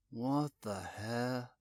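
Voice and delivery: low, slow, quiet shock; stunned. Acted. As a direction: breathe it out stunned. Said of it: "Boone started".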